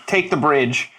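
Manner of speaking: ordinary talk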